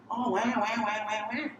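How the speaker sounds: high whiny wail; mock whining. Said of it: "whining and complaining".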